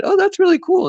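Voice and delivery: high, loud gushing compliment; mock gush like an impressed friend; gushing admirer. Friend admiring the finished project, high delighted gush. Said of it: "if someone said".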